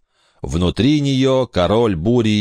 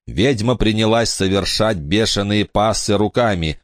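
ordinary delivery, nothing marked

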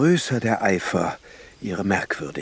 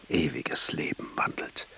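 whisper; 4 semitones lower, 6 dB quieter than usual. Acted. Alchemist drifts into private thought.

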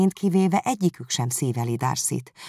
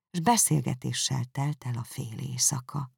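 normal talking voice

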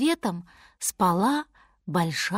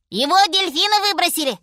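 high, loud, rapid monkey squeal; shrieking little monkey; 9 semitones higher, 5 dB louder, faster than usual. A narrator's reading. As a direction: blurt it out fast in a high monkey voice. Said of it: "закричала обезьянка".

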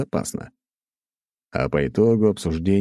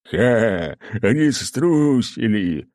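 raised, drawn-out gloating; triumphant growl; 5 semitones higher, 3 dB louder, much slower than usual. Acted. Cowardly husband gloating at fleeing enemies, growled. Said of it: "рыкнул Аленус".

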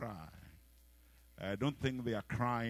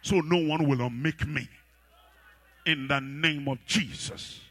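louder preached declaration for all to repeat; 10 dB louder than usual. Acted. Preacher has everyone declare it, loud and bold. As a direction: proclaim it boldly. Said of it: "Everybody say".

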